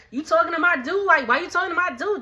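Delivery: a hammed-up [name] squaring up to Alex; mock confrontational taunt. [name] in Alex's face.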